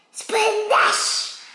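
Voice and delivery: high, drawn-out exclamation; big splash. A narrator's reading. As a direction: draw the word out like a big splash. Acting out a big puddle splash.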